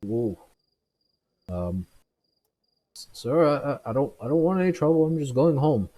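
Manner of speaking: put-on high, loud, stammering drunk; a scared drunk backing away from trouble; nervous drunk. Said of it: "He kinda stops and he goes".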